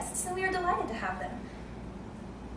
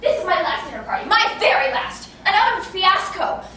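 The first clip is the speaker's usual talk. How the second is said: going mad; 3 semitones higher, 8 dB louder than usual. Acted. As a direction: wail about the ruined dinner party. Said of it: "I shall go mad!".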